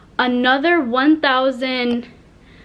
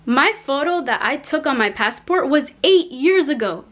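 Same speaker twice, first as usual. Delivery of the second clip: ordinary delivery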